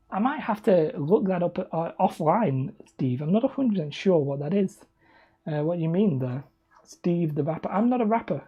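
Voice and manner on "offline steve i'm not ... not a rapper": usual speaking voice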